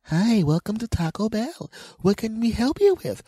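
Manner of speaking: high mock customer-service greeting; chirpy cashier. Cashier greeting him over the machine, chirpy. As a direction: imitate a sugary drive-through welcome.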